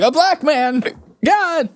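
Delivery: high-pitched panicked cry; hammed-up horror; mock terror. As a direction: imitate a man in a fright. Mock terror of the scared man.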